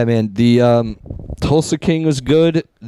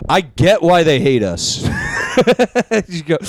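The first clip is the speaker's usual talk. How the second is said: usual speaking voice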